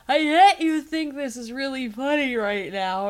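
loud, slurred overacted line reading; bad acting. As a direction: chew the scenery, slurring the words. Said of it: "she's like".

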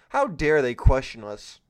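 ordinary talk

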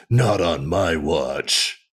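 low, slow villain growl of a line; Scorponok voice. Retold or imitated. Mocking Scorponok to the co-host, low and slow. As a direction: drag it out like a gruff robot scorpion. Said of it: "that's exactly what he would have sounded like".

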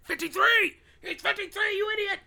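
high screaming rant at an idiot; furious shout. Retold or imitated. A listener screaming at him for taking so long. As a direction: yell it high and furious at them. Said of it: "It was like".

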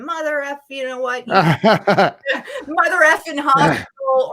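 laughing through a retold swearing fit; retold cussing. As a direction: curse them out, half laughing. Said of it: "I'm cussing".

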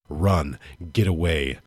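usual speaking voice

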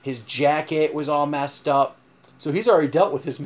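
ordinary talk, nothing marked

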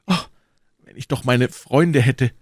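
a touch of a wistful sigh. A narrator's reading. D'Artagnan longing for his friends, mildly sighing. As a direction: wish it aloud with a mild sigh. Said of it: "seufzte er".

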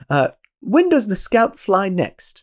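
high, slow British officer question; posh British airman. Acted. Mess hall mate asking Goddard, in a high slow British accent. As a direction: ask it like a proper British airman. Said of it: "a very British gentleman".

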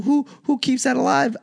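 high-pitched imitation of baffled critics; high, incredulous mock question; mocking disbelief. Mimicking everyone's disbelief about Arby's.

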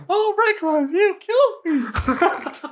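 very high squeaky puppet voice; puppeted severed head. Pretend. Player puppets a severed goblin head, high puppet voice. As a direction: voice the dead head in a silly falsetto. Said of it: "You puppet him?".

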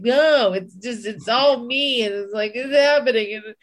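frustrated rant at yourself; frustrated outburst. Retold or imitated. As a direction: vent like you're fed up with yourself. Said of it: "I feel like".

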